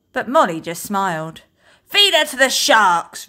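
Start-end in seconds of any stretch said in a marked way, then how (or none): 1.8-3.3 a high, loud pirate roar. Acted. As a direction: roar it across the deck. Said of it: "roared William Woodenhand".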